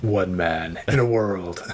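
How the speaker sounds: slow, deep-set trailer announcer; movie trailer voice. Retold or imitated. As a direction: boom it like a blockbuster trailer. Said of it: "it totally starts off with".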